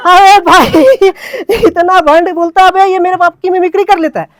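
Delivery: high, loud, laughing through the quote; laughing retelling. Real, not acted. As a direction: crack up while repeating it.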